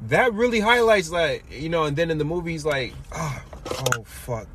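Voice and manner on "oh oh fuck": quiet dismay. Retold or imitated. Acting the movie character's dismay.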